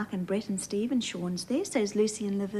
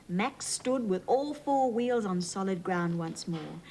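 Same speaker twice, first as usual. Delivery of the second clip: ordinary delivery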